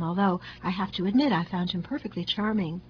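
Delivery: ordinary delivery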